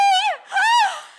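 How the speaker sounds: sharp, very high gasp of wonder. Retold or imitated. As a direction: suck in air, overwhelmed. Realizing she had a baby.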